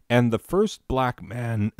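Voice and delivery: ordinary delivery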